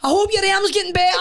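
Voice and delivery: high, laughing put-on politeness; mock polite voice. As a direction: chirp it through a laugh. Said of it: "I was like".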